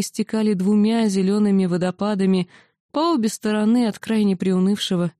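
ordinary delivery, nothing marked

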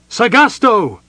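loud, high order; rally the archers; cried out. Acted. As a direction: Mina cries the command again to the archers. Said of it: "Mina cried".

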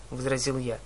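usual speaking voice

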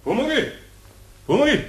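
sing-song call rising high. Acted. Eder calling for Pumuckl across the room, sing-song call. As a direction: call him over from across the workshop. Eder calls the goblin from afar.

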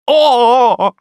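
loud, high, drawn-out "O!"; anguished cry. A narrator's reading. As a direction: wail out in dread. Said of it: "вскричал злополучный смотритель". (громкий протяжный вскрик)

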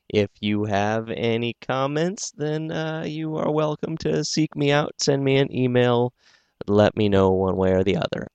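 ordinary delivery, nothing marked

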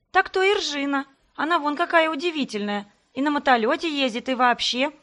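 high, loud, winded call; breathless shout. A narrator's reading. Second twin yells back at Greg mid-chase, winded shout. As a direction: shout while out of breath from running. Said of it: "запыхавшись, крикнула вторая".

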